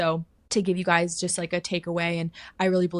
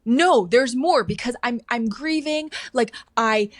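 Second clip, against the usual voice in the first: grieving protest; 5 semitones higher, 6 dB louder than usual. Retold or imitated. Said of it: "And I go".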